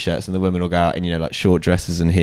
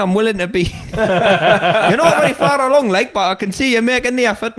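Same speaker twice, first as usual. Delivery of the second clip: much higher, mocking Newcastle Geordie accent; 12 semitones higher than usual. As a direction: ham up a Newcastle Geordie lad. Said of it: "That's a hard accent to pull off".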